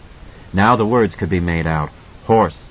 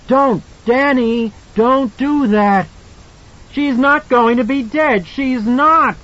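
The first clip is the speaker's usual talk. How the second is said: panicked pleading; 13 semitones higher, slower than usual. A narrator's reading. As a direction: beg him to stop, panicking.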